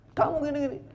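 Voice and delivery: loud, very high mock scolding; acted exasperated complaint; nagging wife. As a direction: jab the accusation way up high.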